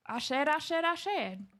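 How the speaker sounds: a hint of the cartoon rooster; a couple bantering, she tries a cartoon rooster voice; rooster impression. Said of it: "the voice I get from the Warner Brothers cartoon".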